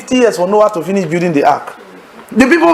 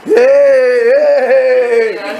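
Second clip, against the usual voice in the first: playful hey; 6 semitones higher, much slower than usual. Retold or imitated. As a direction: call out to her with a playful laugh. Hagin laughing at Sister Benedict.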